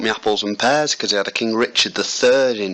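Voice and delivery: cheeky London cockney, lightly put on; a hint of a cockney geezer; cheery cockney showman. Cockney rhyming slang bit.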